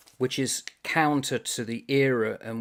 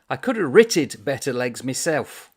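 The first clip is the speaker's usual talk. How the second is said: no accent attempt; 2 semitones higher, 7 dB louder than usual. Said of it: "I can't do the accent, so I won't even try".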